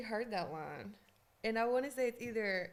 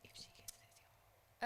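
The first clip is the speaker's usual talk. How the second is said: unvoiced whisper to the room; whispered aside; 17 dB quieter, slower than usual. Real, not acted. Aside to others during trivia.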